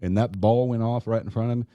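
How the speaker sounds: ordinary talk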